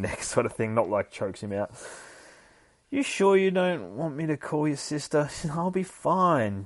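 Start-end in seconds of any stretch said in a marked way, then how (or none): 2.9-5.3 higher, lightly voiced concerned relative; voiced character line. Streamer reading a worried relative's line, higher voice. Voicing game dialogue. 5.5-6.6 slow, higher brave reassurance; voiced character line. Streamer voicing the hero reassuring family, slow and high.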